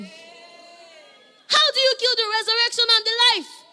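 high, loud cry of triumph; ringing preacher's shout; preaching shout. Real, not acted. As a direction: shout the question up to the rafters. Preacher's rising climax.

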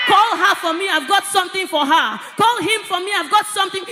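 loud preacher's call across a hall; loud, urgent instruction to the crowd; shouted summons. Acted. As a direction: call someone forward with full voice. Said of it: "you are gonna hear such instructions".

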